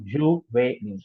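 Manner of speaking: juvénile drawn out slowly, é as AY; juvénile syllable by syllable in French; French accented é. Shown as a demonstration. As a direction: say juvénile slowly as zhoo-vay-neel. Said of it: "The accent here really impacts".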